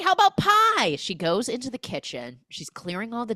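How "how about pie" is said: high, loud false brightness; mock frantic cheer. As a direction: blurt the offer to escape. Retold panicked hostess changing subject.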